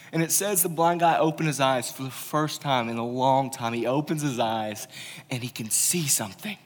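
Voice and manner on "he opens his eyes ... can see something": normal talking voice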